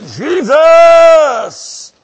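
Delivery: high, drawn-out full shout; crying out. Shown as a demonstration. Preacher showing how Bartimaeus called Jesus, loud cry. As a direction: holler the name at full force. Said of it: "No, he cried out".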